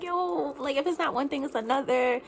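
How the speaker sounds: exasperated retelling of her own reaction on seeing the car. Retold or imitated. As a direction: throw up your hands at yet another problem. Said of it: "automatically went into a negative mode".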